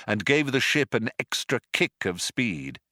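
ordinary delivery

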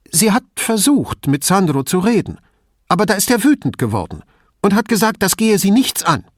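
put-on a mother protecting her child, rising; defensive mother. A narrator's reading.

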